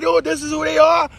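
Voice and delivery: mocking quote. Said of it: "look at a report ... and say".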